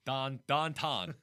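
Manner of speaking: "Don Ton" said slowly as Dahn-tahn, the ow flattened to ah; Pittsburghese downtown. Shown as a demonstration. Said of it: "The next one I gotta work on is downtown".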